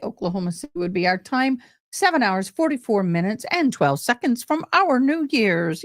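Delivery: ordinary talk, nothing marked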